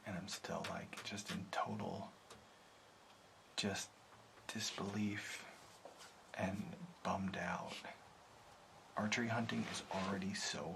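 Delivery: dejected, hushed whisper. Real, not acted. Hunter whispering his disbelief to the camera. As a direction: murmur the letdown softly.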